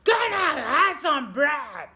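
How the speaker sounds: hammed-up noisy shout; booming shout. A narrator's reading. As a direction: roar it across the shop.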